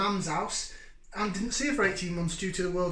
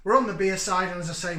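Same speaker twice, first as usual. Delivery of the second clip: ordinary delivery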